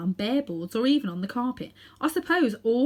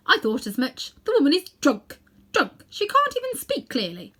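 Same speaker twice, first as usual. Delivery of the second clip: loud, higher prim sniffing scorn; prim disapproval; 7 semitones higher, 5 dB louder than usual. A narrator's reading. Aunt Letty dismissing the witch as drunk. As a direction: condemn the drunken woman.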